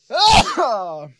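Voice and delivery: loud sudden sneeze; sneezing. Real, not acted. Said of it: "oh, ich muss niesen".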